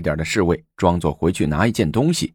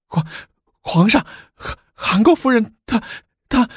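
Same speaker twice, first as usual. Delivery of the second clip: much higher, breaking, stuttering servant; panicked stammer. Acted. Servant bursting in on the emperor, panicked stammering. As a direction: gasp out the news in terror.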